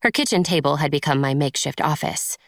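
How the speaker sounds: usual speaking voice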